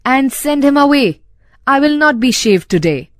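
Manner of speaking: losing all patience. A narrator's reading. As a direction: let patience slip, pitch lifting. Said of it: "losing all patience".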